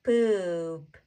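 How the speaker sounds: "Poop" stretched slowly with a long vowel; drawn-out blend. Shown as a demonstration. Teacher blends sounds slowly first.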